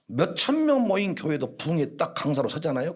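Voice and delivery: ordinary delivery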